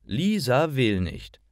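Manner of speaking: plain reading voice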